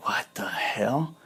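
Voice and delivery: breathless whisper, slow and stunned; whispered disbelief. Retold or imitated. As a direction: hush it like you can't believe what's happening. Shocked as girl kisses him.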